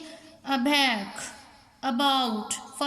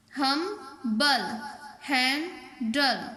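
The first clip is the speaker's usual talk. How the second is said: "हम बल" and "handle" slowly in two beats each; split into syllables; much slower than usual. Shown as a demonstration. Teacher showing letters staying apart, "हम. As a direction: pause before the last syllable of "हम बल", "handle". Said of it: "वो अलग रह जाएगा".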